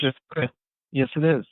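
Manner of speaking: faint voice with the phone held away from the mouth; talking too far from the phone. Real, not acted. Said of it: "speak closer to the phone".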